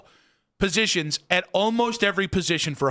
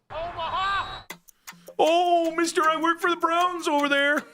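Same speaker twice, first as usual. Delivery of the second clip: high mock yokel voice; 9 semitones higher than usual. Mocking fan calling out.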